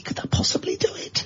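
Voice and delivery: breathy whispered question. Real, not acted. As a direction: drop to a whisper. Whispered aside.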